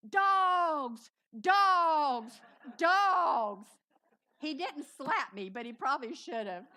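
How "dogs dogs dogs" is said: very loud, high wail on each word; comically distraught yelling; hysterical repeated cry. Retold or imitated. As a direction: bawl one word over and over, drawn out. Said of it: "all I could say was".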